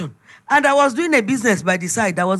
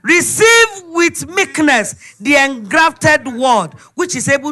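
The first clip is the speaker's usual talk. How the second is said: slow, loud, weighty scripture reading; measured, solemn verse, read out loud; scripture recitation; 5 semitones higher, 5 dB louder, slower than usual. Acted. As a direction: lay each word down slowly like holy writ. Quotes scripture in the sermon.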